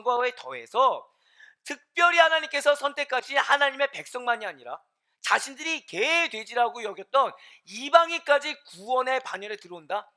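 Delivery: loud, high, emphatic sermon delivery; impassioned preacher in full flow; forceful preaching. Real, not acted. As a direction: drive each phrase home to the congregation at full voice.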